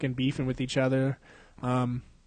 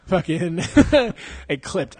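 usual speaking voice

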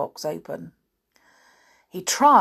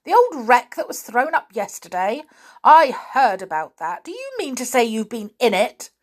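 raised, incredulous questioning; surprised; 6 semitones higher, 6 dB louder than usual. A narrator's reading. Uncle questioning children about the old wreck, surprised. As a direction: press the question in loud disbelief. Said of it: "said her uncle in surprise".

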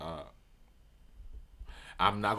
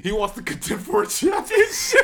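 loud, high, through real laughter; laughing hard; 6 dB louder than usual. Real, not acted. Friend topping the basketball joke, laughing loudly. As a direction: crack up while saying it.

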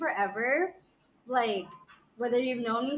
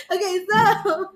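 laughing; 9 semitones higher, 5 dB louder, slower than usual. Real, not acted. Recovering from laughter.

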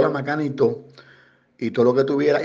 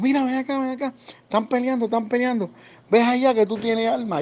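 imitating an excited pastor, much higher; high, urgent mock pastor voice pointing at the fight; pastor urging; 11 semitones higher than usual. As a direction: pastor at the store tells the guard to break up the fight, high and urgent. Retold pastor urging him to intervene.

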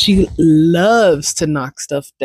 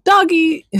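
a higher, lighter "Doggy"; mild doggy exclaim; 13 semitones higher than usual. Retold or imitated. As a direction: say "Doggy" as a happy little exclaim. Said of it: "maybe not with that level of excitement".

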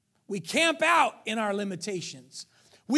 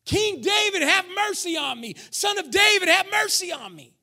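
higher, louder pleading cry; 8 semitones higher, 5 dB louder than usual. Retold or imitated. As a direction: beg with everything, voice up high. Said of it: "cried out all the more".

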